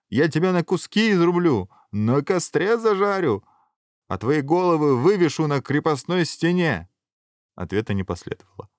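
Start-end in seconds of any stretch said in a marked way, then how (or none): 0.1-6.9 shouted threats. A narrator's reading. Hero threatening the hidden dragon, high bold shouting. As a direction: holler each threat with bravado.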